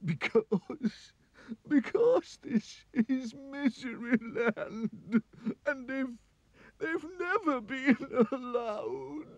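high, tearful wailing king; sobbing king. Acted. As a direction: blubber it out through tears. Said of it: "burst into tears again".

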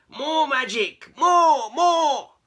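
high, loud demand; excited cry. A narrator's reading. Cats begging Keith for magic, excited cry. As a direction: shout it eagerly. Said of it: "cried excitedly".

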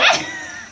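a short high-pitched squeal of laughter. Real, not acted. Laughing at the story's punchline. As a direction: burst into a squeaky giggle.

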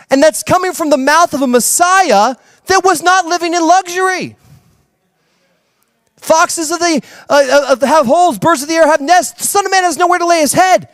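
fervent sermon climax; preaching at full force. Acted. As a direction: drive the truth home from the pulpit.

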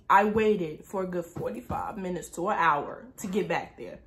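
normal talking voice

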